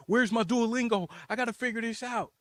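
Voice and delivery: high-pitched flustered scrambling; mock panic. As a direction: act out getting flustered and desperate. Acting out his panic at bakery.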